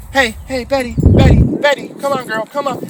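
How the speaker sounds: hammed-up Betty, come on call; mock owner calling. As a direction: imitate an owner begging the dog to come. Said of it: "And they go".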